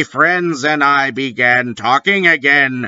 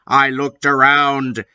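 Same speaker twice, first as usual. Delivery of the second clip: ordinary delivery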